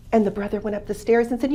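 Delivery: ordinary delivery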